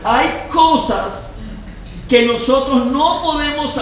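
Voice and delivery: preaching. Real, not acted. Pastor telling the congregation of the boat in the storm, preaching.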